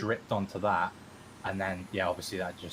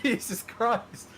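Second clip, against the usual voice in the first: much higher, slow drawn-out dismay; exasperated; 3 dB louder, slower than usual. Retold or imitated. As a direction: stretch it out in disbelief. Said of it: "I was like".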